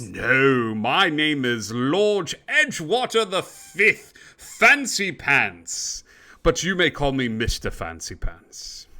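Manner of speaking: grand, slow swooping self-introduction; Mister Fancy Pants. Acted. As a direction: draw out the name like a pompous lord. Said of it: "Mister Fancy Pants looks at you and goes".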